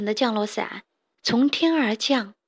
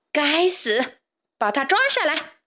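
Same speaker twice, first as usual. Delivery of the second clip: raised-pitch, restrained shout; shouted order; 5 semitones higher than usual. A narrator's reading. Queen orders the guard captain to seize him, shouting. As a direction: call out the command in higher pitch. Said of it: "对卫士长大喊".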